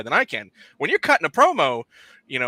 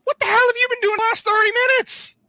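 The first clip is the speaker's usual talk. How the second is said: exasperated yell; 5 dB louder than usual. Retold or imitated. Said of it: "I'm like".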